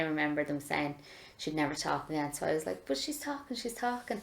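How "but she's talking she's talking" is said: excited delight. Retold or imitated. Thrilled mother spoke again.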